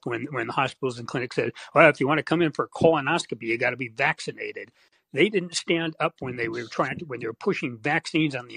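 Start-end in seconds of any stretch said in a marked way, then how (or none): none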